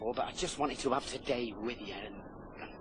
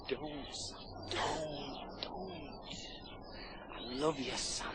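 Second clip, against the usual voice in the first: soft pleading; 9 dB quieter, slower than usual. Acted. Pleading softly in distress.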